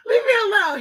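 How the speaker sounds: very high, laughing "leave me alone"; laughing protest. Real, not acted. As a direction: squeal it through laughter. Dog pawing her.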